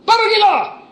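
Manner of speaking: high, loud commanding call; Jesus sending disciples. Retold or imitated. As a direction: proclaim it like a send-off command. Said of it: "dia bilang apa?". (seruan perintah yang tinggi dan lantang)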